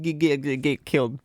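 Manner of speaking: stammering the same word over and over; stuck in a loop. Real, not acted. Tongue-tied while warning players they'll get killed, stuck in a loop. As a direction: trip on "get" repeatedly before "killed". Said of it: "stuck in a loop".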